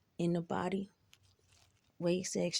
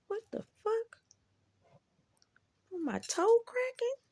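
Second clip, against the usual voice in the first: shocked disbelief. Retold or imitated. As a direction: cry out in disbelief, much higher than usual. Shocked at doctor's list of illnesses.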